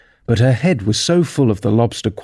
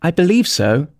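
higher-pitched, pondering reply; thoughtful; 7 semitones higher than usual. A narrator's reading. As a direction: answer as if mulling it over. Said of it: "Alice replied thoughtfully".

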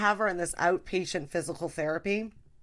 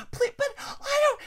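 very high, put-on pleading voice; squeaky mom begging. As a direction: whine out a flimsy excuse. Mom making excuses.

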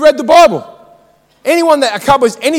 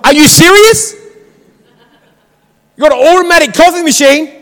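high, loud burst of disbelief; frustrated; 4 semitones higher, 4 dB louder than usual. Retold or imitated. Customer told to park at drive-through, loudly exasperated. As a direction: explode in mock exasperation. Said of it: "I get frustrated".

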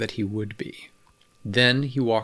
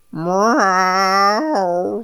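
loud, high, long meow; cat meow; 9 semitones higher, 7 dB louder than usual. A narrator's reading. Cat crying as River settles the neighbor, loud high meow. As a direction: wail like a cat wanting petting. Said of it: "said the cat".

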